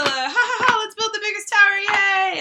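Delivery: high, bright mock glee; giddy excitement. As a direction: play it like a kid thrilled by towers. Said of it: "you do kinda get the".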